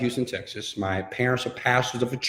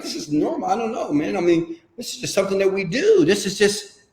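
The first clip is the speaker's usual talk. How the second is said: higher, airy nonchalance about a fortune; breezy as if normal; 7 semitones higher than usual. Retold or imitated. Speaker imitating the rich young man, higher breezy nonchalance. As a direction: toss it off like money is nothing. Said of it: "he said it as if".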